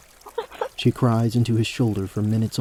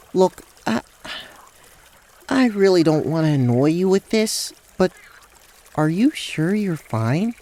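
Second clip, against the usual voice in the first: higher, halting character voice; tentative concern, pitched up; worried, hesitant Rael; 7 semitones higher than usual. Acted.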